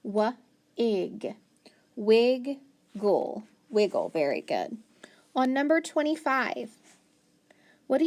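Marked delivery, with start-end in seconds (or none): 0.0-4.1 slow wa-ig, then wig-gle, then wiggle; sounding out wiggle. Shown as a demonstration. Teacher slowly sounding out wiggle for the class. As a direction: break it apart, then blend. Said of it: "I could say".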